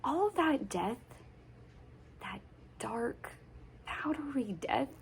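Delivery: hushed, haunted near-whisper with weighted pauses; hushed dread. Acted. Telling of Dad's coal mine, hushed dread. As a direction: let each phrase sink almost to a whisper. Monologue dwelling on the mine's death.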